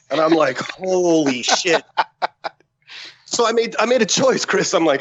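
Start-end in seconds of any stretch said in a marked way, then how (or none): 0.6-1.8 very slow, stretched-out amazement; drawn-out awe. Retold or imitated. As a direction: stretch each word in stunned disbelief. Retelling awe at the general's call.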